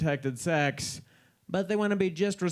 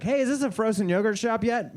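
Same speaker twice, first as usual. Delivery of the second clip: mock innocent; 8 semitones higher, 3 dB louder than usual. Faux-innocent question at studio.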